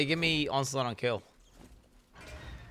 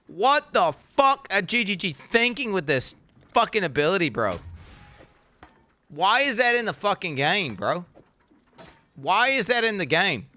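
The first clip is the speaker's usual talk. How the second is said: exasperated rant; 6 semitones higher, 3 dB louder than usual. Real, not acted. Ranting at developers.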